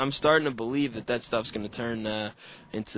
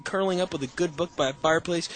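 usual speaking voice

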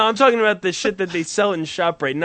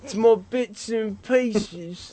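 higher, put-on British accent; mock British accent; 3 semitones higher than usual. A lightly put-on British lad complaining his bits hang out of his Umbros. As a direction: do a cheeky British bloke. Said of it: "I don't do the voices".

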